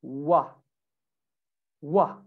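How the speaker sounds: "wa" with rounded lips, no V; a pure W glide on "wa"; W sound. Shown as a demonstration. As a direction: say "wa" as a low, rounded W.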